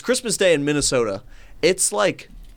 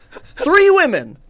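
excited exclamation; 10 semitones higher, 5 dB louder than usual. Real, not acted. Teller exclaims in disbelief about three upset women.

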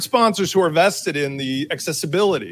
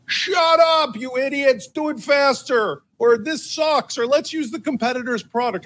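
mock yelling; 10 semitones higher than usual. Tom yelling from the porch at the fence painters, mock angry yelling. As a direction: holler impatiently at the workers, pitch way up. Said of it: "Tom is yelling from the porch".

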